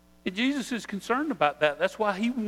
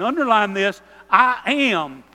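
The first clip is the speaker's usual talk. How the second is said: I AM punched hard and much louder; emphatic I am; 3 semitones higher, 9 dB louder than usual. Retold or imitated. Preacher voicing Jesus to the congregation, emphatic stress on I am. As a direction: hammer the words I am home like underlining them. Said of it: "the way we would emphasize that".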